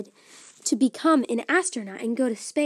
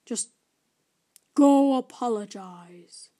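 slow, louder, earnest; firm creature; 5 dB louder than usual. Acted. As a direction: press the point home. Creature commands.

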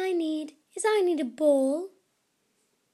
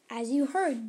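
plain reading voice

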